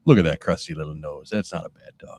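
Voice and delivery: low cooing dog-praise voice; baby-talk a dog in a deep croon. Acted. Host cooing at the dog's photo, low croon.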